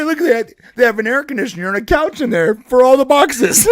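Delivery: high, loud mock sales pitch breaking into laughter; real estate agent. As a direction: play the agent, barely holding back laughs. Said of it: "I become that lady's real estate agent".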